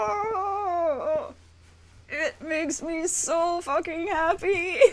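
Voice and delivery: high, stretched tearful wail; happy tears. Real, not acted. Streamer overjoyed for friends, crying happily. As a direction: let happiness tip slowly into crying. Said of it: "I'm trying not to cry".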